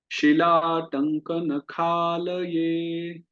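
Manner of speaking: शिलाटंकन खालये drawn out slowly, each syllable separate; slow syllable-by-syllable recitation. Shown as a demonstration. Teacher reciting for class: slow careful Sanskrit recitation. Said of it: "चलिए मेरे साथ उच्चारण कीजिए".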